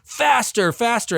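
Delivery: high-pitched mock command to a driver; urgent order. Storyteller as Antiochus ordering his chariot driver, high and urgent. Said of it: "but he orders his driver".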